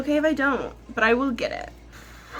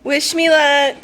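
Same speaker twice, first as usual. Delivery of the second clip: excited; 5 semitones higher, 9 dB louder, slower than usual. Real, not acted. Leaving for interview, repeats.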